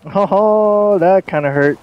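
high, loud wounded yelp; mock hurt. Real, not acted. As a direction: act stung by the burn. Reacting to a mother insult.

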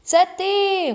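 high, loud retort from afar; hollering back. A narrator's reading. Friend blamed for crawling wrong, hollering back. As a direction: throw the blame back across the field. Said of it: "гукає він".

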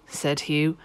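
ordinary delivery, nothing marked

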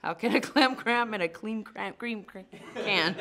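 loud, laughing through a tongue twister. Shown as a demonstration. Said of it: "read this three times fast".

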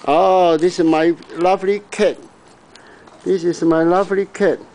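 high, doting pet talk; cooing at the cat. Real, not acted. Owner greeting his cat again, high cooing voice. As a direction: coo over a beloved cat.

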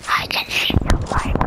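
breathy hushed whisper. Real, not acted. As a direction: whisper it like a secret. Whispered aside.

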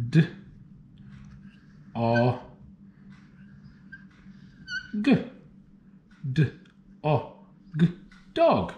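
segment D, o, g then Dog; sounding out words. Shown as a demonstration. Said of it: "Let's write this down".

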